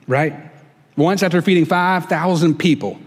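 ordinary delivery

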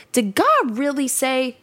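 a touch of a sly, doubting serpent; sly serpent question. Acted. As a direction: read it as the serpent sowing doubt, lightly. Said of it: "see how he says it like that?".

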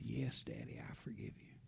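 soft unvoiced whisper; a young son whispering forgiveness to his dad; child's whisper. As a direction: breathe the forgiveness softly.

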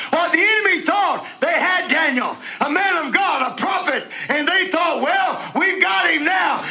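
high, rising, shouted preaching; preaching at full force. Acted. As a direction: build the fire of the sermon, calling out.

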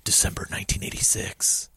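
hushed whispered date stamp; whispered briefing read. Acted. Reading the game's briefing date, hushed whisper. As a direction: whisper it like a secret mission file.

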